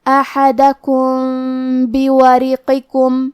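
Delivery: very slow recitation of احدکم بوارقکم, meem hidden into ba with nasal ghunna. Shown as a demonstration. Teacher modelling ikhfa for students, slow nasal hidden meem. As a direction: recite احدکم بوارقکم slowly, equal zabars, meem hummed before ba. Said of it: "میم ساکن کو چھپاتے ہوئے اور غنہ کے ساتھ".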